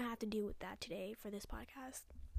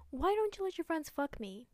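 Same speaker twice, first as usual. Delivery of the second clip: higher, louder, sneering mock voice; mocking sarcasm; 6 semitones higher, 5 dB louder than usual. Sister mocking what her brother expected her to say, sneering. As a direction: throw his idea back at him with scorn.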